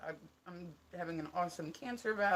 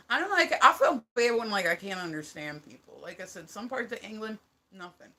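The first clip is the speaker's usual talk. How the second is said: normal talking voice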